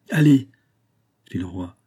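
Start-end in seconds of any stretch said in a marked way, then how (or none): 0.1-0.5 loud, high order; curt command. A narrator's reading. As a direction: send him off forcefully. King orders musketeer off. (ordre lancé d'une voix forte)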